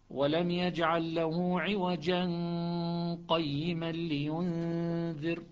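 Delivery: slow recitation, عوجا joined straight into قيما; Shuba, no pause. Shown as a demonstration. Teacher demonstrating Shuba's joined reading. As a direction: run عوجا on into قيما with no stop. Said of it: "ما عنده سكت".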